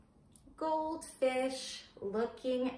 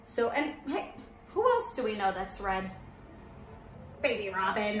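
usual speaking voice

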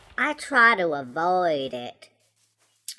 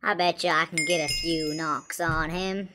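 ordinary delivery

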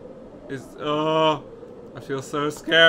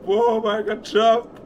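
normal talking voice

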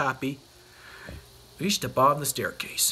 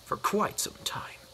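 whispered aside; 5 semitones higher, 5 dB quieter than usual. A narrator's reading. Narration drops to whisper.

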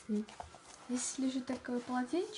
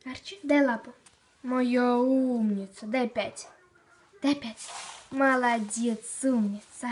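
loud dog commands; 8 dB louder, slower than usual. Real, not acted.

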